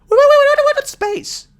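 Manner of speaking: high, loud stammering squeal; incredulous outburst. Real, not acted. Ranting comic to listeners about humans reaching space, incredulous outburst. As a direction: burst out, tripping over the first word in disbelief.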